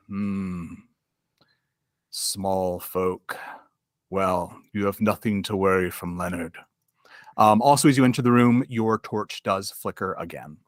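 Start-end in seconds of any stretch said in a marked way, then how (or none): 0.1-6.6 lightly voiced weary giant knight, a little low; sighing knight. Giant knight greets small folk stepping into his room, sighing. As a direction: say it on a sigh, as a knight. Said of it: "he sighs and says".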